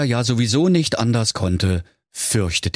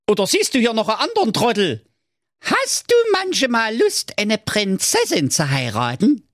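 high, pompous little king calling out; King Curry. A narrator's reading. Currywurst king calling Thorsten over on the steps, high and bossy.

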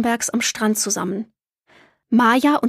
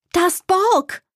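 high, excited call out; girl spotting her friend suddenly; 9 semitones higher, slower than usual. A narrator's reading. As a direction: point him out in a flash. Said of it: "rief Maya plötzlich".

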